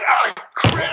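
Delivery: high, anguished yelp of frustration; retold cry of dismay; frustrated outcry. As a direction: groan it out like you just lost the deal. Frustrated outburst at missed sale.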